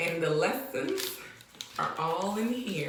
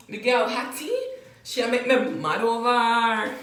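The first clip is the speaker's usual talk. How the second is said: louder Jamaican patois admiration; Jamaican man admiring; 4 semitones higher, 6 dB louder than usual. Retold or imitated. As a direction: Jamaican guy hyped about a girl. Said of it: "Jamaican man admiring a girl".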